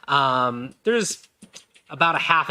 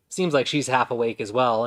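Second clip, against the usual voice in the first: normal talking voice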